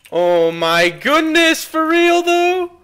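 loud, slow, stretched-out disbelief; high, loud drawn-out amazement; stunned disbelief. Real, not acted. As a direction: drag it out, unable to believe it. Stunned by enemy survival.

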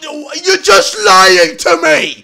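loud, slow accusing yell at the game; exasperated yell. Real, not acted. Gamer yelling at the quiz game, loud and outraged. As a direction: drag it out, outraged at being lied to.

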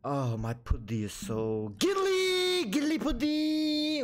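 slow, silly made-up voice, going high; silly sing-song voice. Pretend. Player goofing around with nonsense words, slow silly voice.

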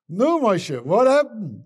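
high, loud questioning; acted, pushy greeting; loud eager demand. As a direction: demand the news from an old friend. Friend eagerly demands news after trip.

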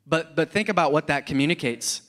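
ordinary talk

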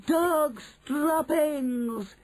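high, drawn-out witch's yell. Acted. Witch reports the smell, long high yell. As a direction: yell it high, stretching each word. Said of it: "she yelled".

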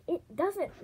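indignant, jumping up in pitch; growled retort. A narrator's reading. Winter defending his scavenger to Kibble, indignant growl. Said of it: "Winter growled".

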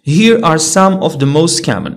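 ordinary delivery

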